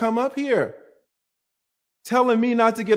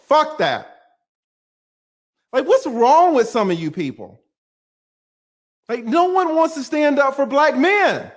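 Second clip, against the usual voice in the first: loud, higher angry yelling; furious shout; 8 semitones higher, 4 dB louder than usual. Real, not acted. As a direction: yell it in real outrage. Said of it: "if I am screaming".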